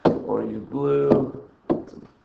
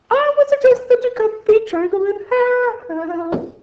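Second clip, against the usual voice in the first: very high, slow, singsong voice; sing-song it in a silly squeak; goofy falsetto; 4 dB louder than usual. Pretend. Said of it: "hear me sing".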